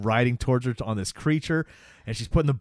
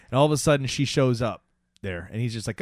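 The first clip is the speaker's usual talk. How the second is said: ordinary talk, nothing marked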